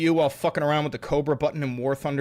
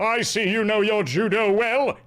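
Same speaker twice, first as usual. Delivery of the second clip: hammed-up stage voice; theatrical orator; 4 semitones higher than usual. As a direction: declaim it with pompous relish. Same arrest speech impression.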